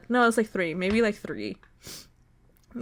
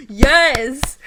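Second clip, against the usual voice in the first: loud, excited outburst; real excitement; 3 semitones higher, 10 dB louder than usual. Real, not acted. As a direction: burst out loudly, thrilled. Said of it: "I'm so excited".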